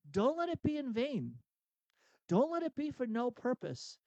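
high-pitched, emphatic exhortation; urgent imperative. Acted. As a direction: plead it like a command to yourself. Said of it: "say it as an imperative".

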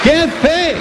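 high-pitched imitated exclamation; mock amazement. People reacting to his faith, mock amazement. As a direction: exclaim like astonished onlookers, voice jumping up high.

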